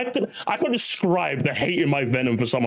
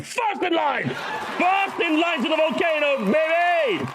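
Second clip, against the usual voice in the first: high, shouted gloat, slow in the middle; hyped rant; 12 semitones higher, much slower than usual. Real, not acted. Streamer hyping chat about the leaker, high shouted gloat. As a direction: bellow it like a wrestling promo.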